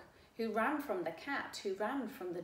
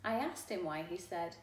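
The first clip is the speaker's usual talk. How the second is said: ordinary delivery, nothing marked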